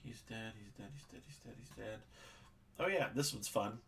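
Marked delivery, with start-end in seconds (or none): 0.0-2.0 muttered list. Real, not acted. The game master murmurs to himself, ticking off dead monsters. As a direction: mumble it under your breath while checking.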